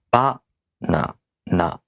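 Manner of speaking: Ba-na-na slowly, syllable by syllable, Ba accented; slow Japanese BA, na, na with gaps; accented first syllable. Shown as a demonstration. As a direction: separate Ba-na-na into three beats, first one highest. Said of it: "Accented syllables are more emphasized".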